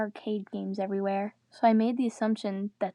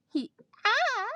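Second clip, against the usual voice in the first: short cry of pain, much higher; mock pained scream; 12 semitones higher than usual. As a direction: yelp as if stabbed, hammed-up. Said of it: "He screamed in pain".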